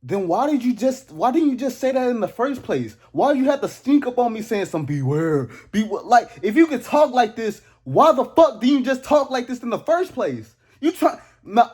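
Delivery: high, indignant rant; annoyed yelling at the ghost; exasperated. Acted. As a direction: demand answers, fed up.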